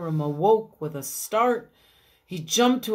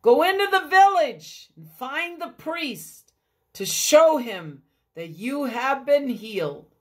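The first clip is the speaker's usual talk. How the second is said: loud, high call across a distance; Jesus calling to the distant lepers; 5 semitones higher, 4 dB louder than usual. Acted. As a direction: project to men far away. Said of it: "called out across the field".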